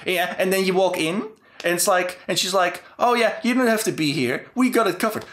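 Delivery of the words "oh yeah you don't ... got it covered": mock breezy brush-off. Mimicking the dismissive detective agency girl.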